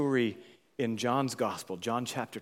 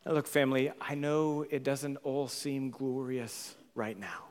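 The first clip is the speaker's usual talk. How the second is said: ordinary talk, nothing marked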